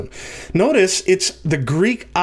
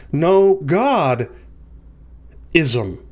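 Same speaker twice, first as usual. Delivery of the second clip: "no God" as one emphatic unit, then "ism" alone; pause before "ism"; much slower than usual. Shown as a demonstration. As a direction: leave a long gap after "no God". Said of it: "In other words, the belief that no gods exist".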